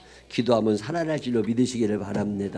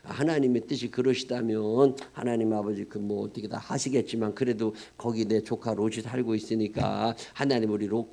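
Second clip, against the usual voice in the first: ordinary delivery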